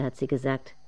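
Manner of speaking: usual speaking voice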